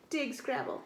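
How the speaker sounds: ordinary delivery, nothing marked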